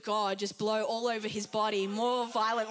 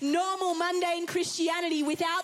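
fiery preaching; 4 semitones higher than usual. Real, not acted. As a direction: preach it with rising fire. Preaching at full force.

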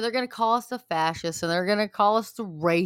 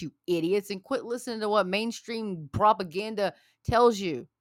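usual speaking voice

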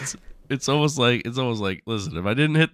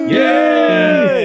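high loud cheer; excited yay; 10 dB louder than usual. Real, not acted. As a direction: squeal with joy. Midnight celebration.

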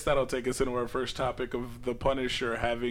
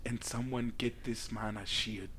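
mock T'Challa African accent; 5 semitones lower, 11 dB quieter, slower than usual.

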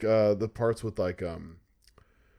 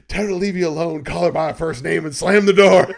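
louder, higher scheming monster voice; hormone monster urging; 6 semitones higher, 11 dB louder than usual. Retold or imitated. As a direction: egg her on, rising to the slam. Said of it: "the hormone voice is like".

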